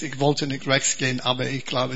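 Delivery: ordinary delivery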